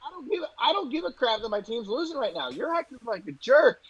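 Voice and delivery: high-pitched, indignant retelling without shouting; retold angry retort. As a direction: replay telling off a fan, pitched right up. Retold confrontation with drunk fan.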